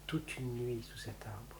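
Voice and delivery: slow breathy whisper. A narrator's reading. Narrator recalls his night under the tree, slowly whispered. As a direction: let it fall to a slow whisper.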